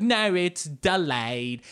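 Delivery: drawn-out, insistent British correction; mock British agent sticking to her story; bad British accent. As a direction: stretch DELAYED in a put-on British accent. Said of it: "there's this British woman back there".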